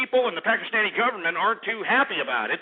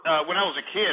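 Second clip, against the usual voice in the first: ordinary talk, nothing marked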